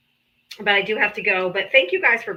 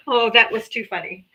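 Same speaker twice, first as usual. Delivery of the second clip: normal talking voice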